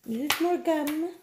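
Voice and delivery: high, slow drawn-out silly voice. Pretend. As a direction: stretch it out in a goofy high voice. Repeats name in funny voice.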